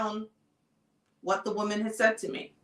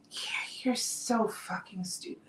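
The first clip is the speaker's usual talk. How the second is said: whisper; 9 dB quieter than usual. Retold or imitated. Looking at husband, inner contempt.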